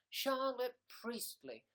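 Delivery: savoured name. Acted. Savouring a viewer's name she loves, slow and high. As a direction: relish the name. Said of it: "I love that name".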